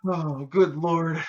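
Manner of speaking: slow, high groan of disbelief; exasperated. Real, not acted. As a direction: drag it out like you can't believe it. Exasperated at the tech's meter story.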